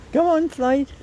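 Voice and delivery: high carrying call to the dog. Real, not acted. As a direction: call him over from a distance. Calling dog on a walk.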